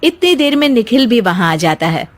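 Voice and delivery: plain reading voice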